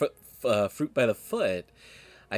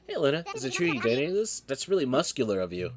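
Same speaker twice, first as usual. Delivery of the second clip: normal talking voice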